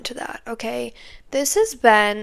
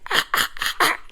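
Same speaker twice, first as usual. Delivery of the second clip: harsh, repeated mechanical bursts; imitated MRI noise; 6 semitones higher than usual. Patient mimicking the MRI machine going, in choppy noisy bursts. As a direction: mimic the scanner's pulsing racket with the mouth. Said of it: "as it's, like".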